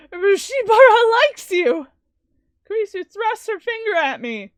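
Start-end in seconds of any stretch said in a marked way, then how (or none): 0.1-1.9 exasperated shout. Acted. Kurisu thrusts finger, exasperated.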